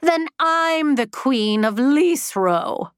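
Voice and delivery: high-pitched, nasal, slow and scornful; high nasal ghost voice. A narrator's reading. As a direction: drag it out in a high nasal sneer. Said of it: "said a high-pitched nasal voice".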